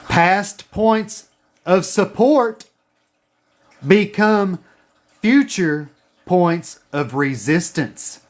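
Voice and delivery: slow, deliberate dictation; dictation pace. Shown as a demonstration. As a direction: say it slowly for them to write down. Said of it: "Write this down".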